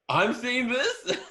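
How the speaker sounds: high, drawn-out disbelief breaking into laughter; incredulous shock. Retold or imitated. Actor spotting a chunk of new script, incredulous shock. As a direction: squeal it slowly in mock horror.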